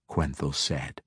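ordinary delivery

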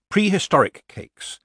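ordinary delivery

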